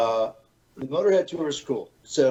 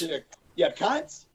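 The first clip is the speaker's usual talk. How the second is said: put-on Australian accent; 6 semitones higher, 5 dB quieter, slower than usual. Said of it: "I start talking to Australian guys".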